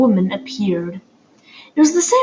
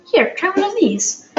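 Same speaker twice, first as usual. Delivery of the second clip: put-on girl voice; 10 semitones higher than usual. A narrator's reading. Poppy offering cookie.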